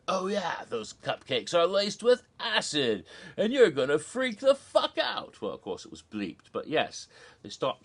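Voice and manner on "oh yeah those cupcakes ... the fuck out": high, rising hammed-up announcement; gleeful mock reveal. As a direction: build to a high, emphatic finish. Villain gleefully reveals laced cupcakes.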